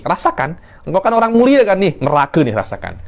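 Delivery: high-pitched taunt, dropping away at the end; acted sneering mockery, swooping high; mocking. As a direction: jeer at someone sent to hell. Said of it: "ada mocking kan".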